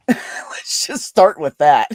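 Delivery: loud, slow words through a laugh. Real, not acted. Admitting she's out of their league, laughing. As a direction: say it while really laughing. Laughing at own self-deprecating remark.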